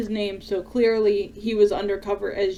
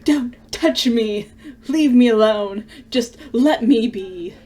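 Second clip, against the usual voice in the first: distraught yelling; 5 dB louder than usual. Acted. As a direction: push them away, voice raised. Character shoves others away.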